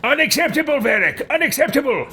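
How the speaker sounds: very high, indignant imperious outburst; pompous outrage; haughty scolding. Acted. Haughty character scolds companions.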